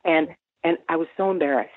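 ordinary delivery

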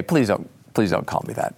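low, subdued objection; Dick Cheney. Acted. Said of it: "He'd say it in a very deep voice".

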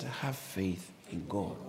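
slow, soft scripture quote; solemn quote. Retold or imitated. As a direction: quote Jesus gently and slowly. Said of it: "Jesus said".

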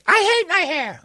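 very high, complaining woman's voice; high whiny woman's voice. Retold or imitated. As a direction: squeal it like a fed-up wife. Wife rejecting compliment.

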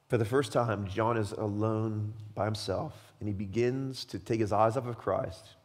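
normal talking voice

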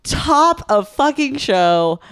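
slow, high, emphatic call-out; imitated stage manager call. Stage manager calling top of show, slow mock holler. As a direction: punch out each word as a backstage announcement.

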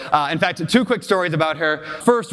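ordinary talk, nothing marked

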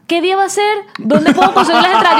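high, laughing clueless fan voice; mock the people who didn't read; mocking commenters. S0 mocking Instagram commenters asking what is already posted. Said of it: "te escriben en los comentarios".

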